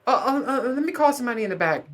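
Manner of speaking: high, fast mock store-clerk voice; mock cashier. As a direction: pitch it way up like a flustered clerk stalling. Cashier stalls about sriracha.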